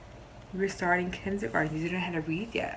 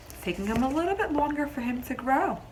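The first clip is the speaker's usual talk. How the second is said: plain reading voice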